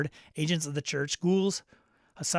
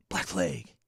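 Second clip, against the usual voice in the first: lower, lightly voiced dramatic growl; mock movie-trailer voice; 10 semitones lower than usual. Echoing the game's name, lightly voiced trailer drama. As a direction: drop low like a trailer narrator.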